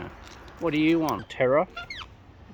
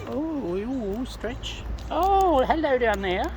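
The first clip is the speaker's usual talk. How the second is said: sing-song hello, pitched well up; high cooing greeting; 9 semitones higher, slower than usual. Real, not acted. Greeting a bird down below, high and cooing.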